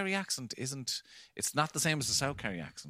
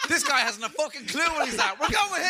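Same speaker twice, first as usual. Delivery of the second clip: high-pitched, loud exclamation; high, loud mock outburst; exclaiming; 6 dB louder than usual. Acting out someone watching the show.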